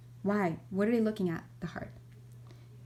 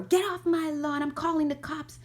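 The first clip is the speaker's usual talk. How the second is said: mock angry old man; 8 semitones higher than usual. Bitter neighbour opening the door to yell at kids, higher cranky yell. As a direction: threaten the kids, half-joking. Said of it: "opening the door".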